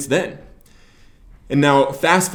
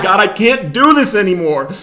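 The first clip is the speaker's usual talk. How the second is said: higher, louder desperate yell; yelling at God; 7 semitones higher, 7 dB louder than usual. Retold or imitated. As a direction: shout it up at God in despair. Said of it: "I started yelling at him".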